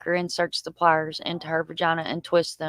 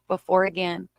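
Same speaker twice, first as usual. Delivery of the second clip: ordinary talk, nothing marked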